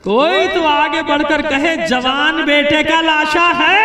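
loud, high drawn-out lament; anguished cry. Acted. Preacher to mourners over the young son's body, anguished cry. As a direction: cry it out to the whole gathering, slowing at the end.

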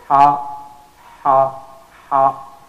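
ha pushed hard and loud from mid-throat; loud throaty ha. Shown as a demonstration. Teacher drilling the Arabic ha loudly. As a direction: breathe ha out forcefully. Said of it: "That's from the middle of the throat".

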